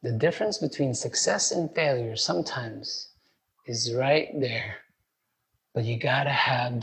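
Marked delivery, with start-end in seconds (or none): 0.0-4.8 "difference" softer and lower, reflective; quiet repeat. Shown as a demonstration. Preacher repeats the line to the congregation, softer and reflective. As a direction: let "failure" fall away quietly. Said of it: "and the Lord's commandment".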